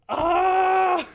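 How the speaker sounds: long, high cry of joy; elated high cry. Real, not acted. Host overjoyed after a fan's call, high elated cry. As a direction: let out a drawn-out, high happy wail.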